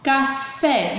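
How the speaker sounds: "café" slowly, with a firm FÉ; stress on last syllable. Shown as a demonstration. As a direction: split "café", leaning on the final FÉ. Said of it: "a última mais forte". ("café" com força no FÉ)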